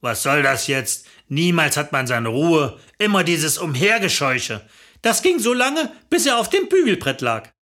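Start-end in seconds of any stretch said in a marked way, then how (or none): 0.0-4.6 low-pitched grumble; grouchy man, voice pitched low; grumbling husband. A narrator's reading. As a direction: moan like a put-upon husband. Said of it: "ständig dieses Murren".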